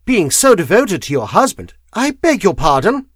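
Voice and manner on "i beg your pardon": high, loud, sharp cut-in; sharp foreign-accented rebuke; sharp interruption. A narrator's reading. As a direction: cut across him in offence. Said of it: "The sharpness of her voice".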